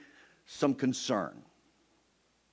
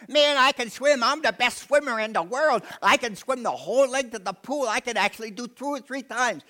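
put-on much higher, louder boastful kid voice; bragging kid; 11 semitones higher, 5 dB louder than usual. Preacher imitating a boastful kid at the pool, high and cocky. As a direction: brag like a cocky little boy. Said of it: "kept bragging on and on".

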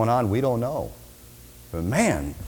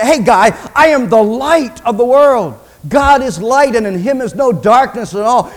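loud, high preaching force; booming raised proclamation; God proclaiming; 7 semitones higher, 7 dB louder than usual. Acted. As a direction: declare it to the whole hall. God proclaims himself to Adam.

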